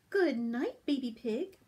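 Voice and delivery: ordinary delivery, nothing marked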